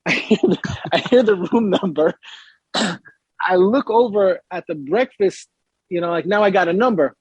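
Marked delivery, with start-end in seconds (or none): none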